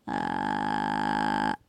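creaky low fry on a long ah; slow rattling creak from the throat; vocal fry. Shown as a demonstration. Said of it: "¿Qué es esto?". (fry vocal)

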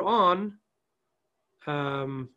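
choppy freezing connection; glitching audio. Real, not acted. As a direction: audio cutting out on a bad line. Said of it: "You keep freezing".

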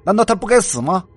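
loud, rising angry argument; heated justification. A narrator's reading. Crazed killer justifies himself to He Yuan, heated and loud. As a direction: press each accusation harder, pitch climbing.

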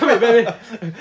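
high, loud mock lover voice; close warm intimate boyfriend. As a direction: coo at a lover, hammed up. Mock romantic call.

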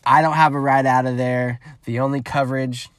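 usual speaking voice

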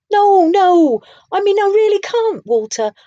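insisting it's hopeless; woeful. A narrator's reading. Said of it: "Hubert said woefully".

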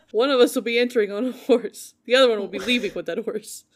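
cracking up mid-quote; loud laughing read. Retold or imitated.

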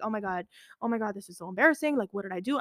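ordinary talk

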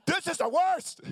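high, loud despairing wail; exasperated wail. Retold or imitated. Husband lost abroad wailing to his sick wife, high and loud. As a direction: throw up your hands in total defeat. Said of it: "I'm like".

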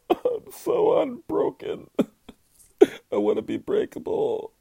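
high, wailing fake sob; hammed-up baby blubbering; mock blubbering. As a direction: ham up crying like a baby over a show ending. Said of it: "blubbering like a goddamn baby".

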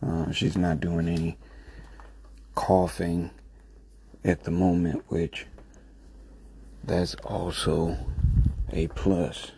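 soft, hushed voice so as not to wake someone; low talking. Real, not acted. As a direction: keep it low, talking quietly. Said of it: "kinda low talking right now 'cause I don't want to disturb her".

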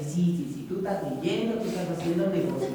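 a touch of quiet sarcasm; mocking inner voice. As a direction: humor them with a quiet mocking yes-yes. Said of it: "se empieza a reír de ti".

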